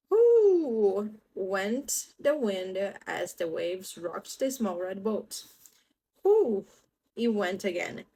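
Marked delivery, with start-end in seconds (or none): 0.1-1.2 loud high whooshing wind. Acted. Wind sound in story. 6.2-6.7 loud high whooshing wind. Acted. Wind again.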